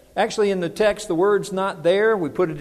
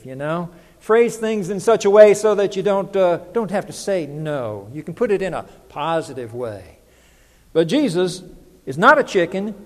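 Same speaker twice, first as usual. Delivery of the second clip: ordinary talk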